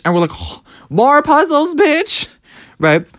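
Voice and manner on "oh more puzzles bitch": mock whine. Mock complaint about more puzzles.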